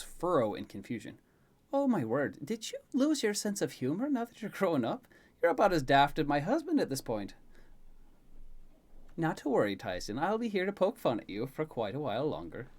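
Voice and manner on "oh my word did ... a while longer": lively, lilting character voice; teasing Elaine. Acted. Elaine teasing Tyson about growing up, playful. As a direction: poke fun fondly, pitch swooping up.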